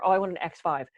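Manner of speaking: ordinary delivery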